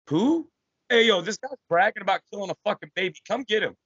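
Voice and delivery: mock bystander call-out. Bystanders voiced in comic.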